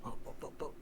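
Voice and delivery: soft whispered murmur; whispering. Real, not acted. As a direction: breathe the words, no voice. GM scrolling map, murmuring.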